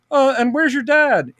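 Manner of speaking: high little voice, friendly hello; George Lucas greeting. Retold or imitated. George Lucas greeting Bryce, high friendly voice. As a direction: greet her warmly in a high puppet voice. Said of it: "George goes".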